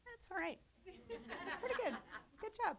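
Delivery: put-on high cooing like praising a child; mock praise. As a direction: pat her on the head with your voice. Praising the helper's "Brilliant.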